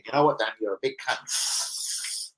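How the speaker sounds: quiet, slow imagined viewer voice; a hushed, slow muttered put-down; viewer's inner voice. Retold or imitated. Streamer voicing a viewer's private thought, quiet and slow.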